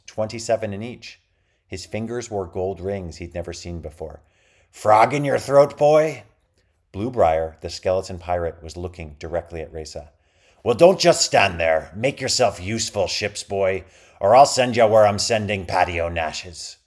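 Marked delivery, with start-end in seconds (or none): 4.7-6.2 loud, slow, growling pirate threat; pirate captain voice. A narrator's reading. Bluebriar addresses Reza. 10.6-16.8 pirate captain voice. A narrator's reading. Bluebriar orders ship's boy.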